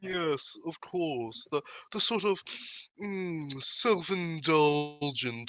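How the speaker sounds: high, drawn-out haughty purr; self-indulgent haughty voice. Acted. Listener tries out the NPC's voice, high and self-indulgent. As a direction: stretch it slowly with indulgent relish. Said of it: "of haughtiness".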